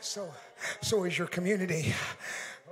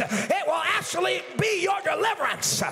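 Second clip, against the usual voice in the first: preaching at full force; 6 semitones higher than usual. Acted. Pastor to his church, full-force preaching shout. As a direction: build the sermon to a shouting climax.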